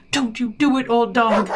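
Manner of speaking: high, loud urgent shout. A narrator's reading. Owner shouts to the strangling dog, shouting. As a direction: shout to stop him. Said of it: "I up and shouted".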